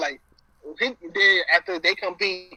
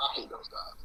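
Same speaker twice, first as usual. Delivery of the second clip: unvoiced sneering whisper; 16 dB quieter than usual. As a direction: whisper it behind their backs. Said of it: "Not like that".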